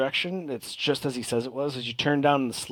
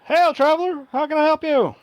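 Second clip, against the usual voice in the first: much higher, louder call from the deck; masked sailor hailing; 7 dB louder than usual. Acted. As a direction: call out to a stranger at the gangplank, high and bright. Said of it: "And he looks over and he goes".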